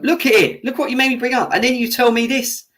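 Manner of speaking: real heated outburst; angry rant. Real, not acted. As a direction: shove the evidence in his face. Ranting at the foul replay.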